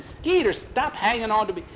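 high-pitched complaint; exasperated telling-off; annoyed protest. A narrator's reading. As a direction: snap it out high, shaking off a clinger. Annoyed at Skeeter clinging.

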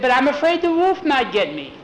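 high, hammed-up little-girl fretting; play-acted worry. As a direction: fret in a high stage voice.

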